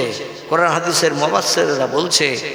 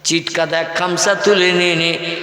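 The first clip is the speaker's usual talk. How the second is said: ordinary talk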